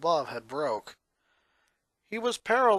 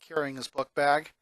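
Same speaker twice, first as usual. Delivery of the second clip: plain reading voice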